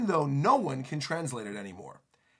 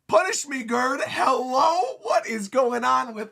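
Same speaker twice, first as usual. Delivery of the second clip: giddy swoon; 7 semitones higher, 5 dB louder, slower than usual. Acted. Road swooning at Gerd.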